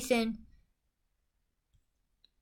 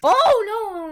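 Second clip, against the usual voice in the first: startled; 8 semitones higher, 8 dB louder than usual. Real, not acted. Scared by a card.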